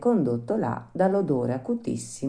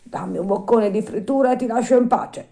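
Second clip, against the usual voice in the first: higher, louder pleading dog voice; lightly voiced begging dog; whimpering dog; 9 semitones higher, 5 dB louder than usual. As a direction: beg for food like a hungry dog. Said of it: "mugolando e dimenando la coda pareva che dicesse".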